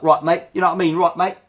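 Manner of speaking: put-on a playful, lightly done Cockney accent. Showing viewers what the Cockney accent sounds like, playful Cockney accent. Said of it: "the Cockney accent is like".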